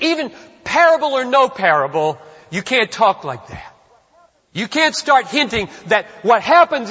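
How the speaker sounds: loud, high impassioned preaching; preaching at full force. Acted. Preacher thundering at the congregation about blasphemy, loud and fervent. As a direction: thunder it from the pulpit. Preacher builds to passionate climax.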